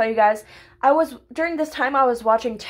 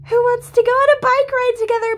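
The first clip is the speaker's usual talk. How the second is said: cheery; 12 semitones higher, 6 dB louder than usual. Retold or imitated. Quoting her own cheery post.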